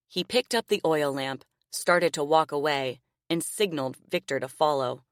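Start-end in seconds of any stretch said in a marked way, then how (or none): none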